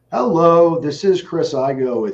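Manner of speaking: high, loud sales pitch hello; phone greeting voice. Shown as a demonstration. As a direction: sell it with a confident hello. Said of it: "we get to call them and tell".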